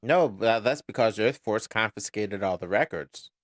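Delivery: usual speaking voice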